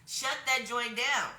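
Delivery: slow, punched self-censored redo of Shut that joint down; swapping the cuss word out with a grin; emphatic correction. Real, not acted. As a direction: lean hard on each word of Shut that joint down. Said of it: "gonna stop cussing".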